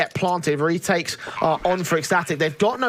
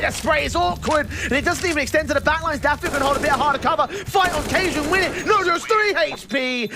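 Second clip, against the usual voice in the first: excited casting; 9 semitones higher than usual. Real, not acted. Caster hyping a late-round fight.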